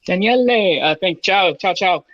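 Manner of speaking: put-on hammy Italian greeting accent. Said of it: "that's my worst Italian accent".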